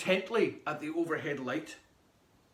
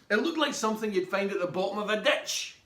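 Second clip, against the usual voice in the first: usual speaking voice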